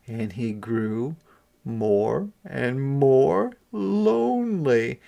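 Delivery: plain reading voice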